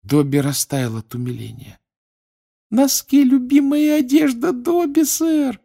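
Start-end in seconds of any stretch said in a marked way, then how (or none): 2.7-5.6 squeaky elf voice. Acted. Dobby delighted.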